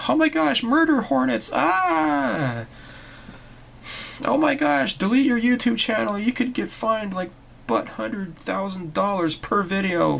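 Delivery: shrill, high, loud hammed-up panic; mock panic. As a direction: parody a hysterical alarmist, squealing. Mocking panicked people.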